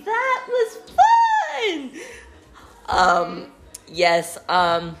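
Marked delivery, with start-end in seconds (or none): 0.0-1.9 high excited cheer; excited shout. Real, not acted. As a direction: call it out excitedly. Cheering after a segment.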